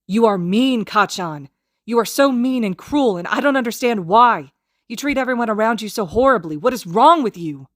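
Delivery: high, loud furious shouting. Acted. Izuku, chest heaving, shouting his anger at Kacchan. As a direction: let it all pour out at full volume. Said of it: "Izuku shouts".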